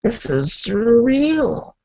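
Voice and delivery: high shouted wow, then slow marvel; stunned by the empty place; awed wow. Retold or imitated. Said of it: "I'm like".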